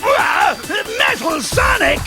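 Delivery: high startled exclamation; stunned villain outburst; shocked recognition. Acted. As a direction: gasp out the robot's name in shock. Villain recognises the impostor robot.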